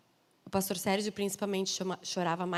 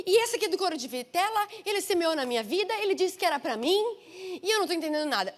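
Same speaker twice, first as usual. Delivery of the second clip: indignada, brigando com Deus; 5 semitones higher than usual. Retold or imitated. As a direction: act out a cross protest to the Lord, building then easing off. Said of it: "Ele brigando no quarto". (reclamando indignada, em tom de briga encenada)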